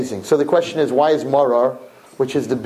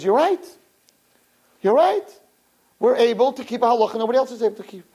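higher, quickening agreement; dawning joy; 8 semitones higher than usual. Retold or imitated. Crying brother agreeing, joyful and higher. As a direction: catch his brother's joy. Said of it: "And he says".

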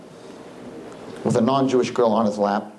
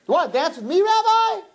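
much higher, incredulous squeak; surprised young man. Retold or imitated. Howie doubting the rabbi's offer, high astonished voice. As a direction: ask it in high disbelief. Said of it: "He goes".

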